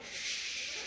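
long, soft whoosh; whooshing noise. Retold or imitated. Boy flying his toy dragon past clouds, soft whoosh. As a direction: imitate a child's flying sound effect.

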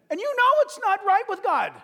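very loud, high-pitched exclamation; preacher's rebuke. Real, not acted. Preacher confronting a tempted believer, loud rebuke. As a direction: belt it out as a warning.

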